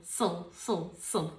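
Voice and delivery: sil said with little mouth opening; small-mouth sil. Shown as a demonstration. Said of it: "Can you hear that?".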